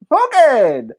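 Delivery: called out high and loud; announcing a name. Real, not acted. Host calls out the first contestant with flair. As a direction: call the name like a game host.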